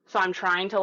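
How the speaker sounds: ordinary delivery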